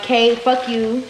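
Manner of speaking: slow, offhand "Kay, fuck you" as a mock brush-off; mock dismissive brush-off. Host imagining guests brushing her off, mock dismissive. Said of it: "Y'all could have been like".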